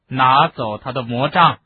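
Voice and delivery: loud, slow order; loud command. A narrator's reading. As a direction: order the wand taken. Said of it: "大声说".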